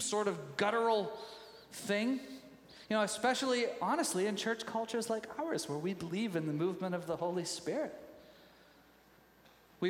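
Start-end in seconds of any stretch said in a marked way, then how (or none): none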